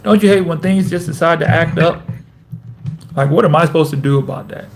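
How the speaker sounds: ordinary delivery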